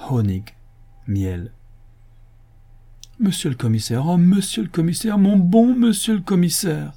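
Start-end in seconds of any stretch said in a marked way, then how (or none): 3.2-6.9 sighing plea. A narrator's reading. Absalom imploring the good commissioner to hear him, sighing. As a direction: build from a sigh to loud imploring. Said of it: "soupira Absalom".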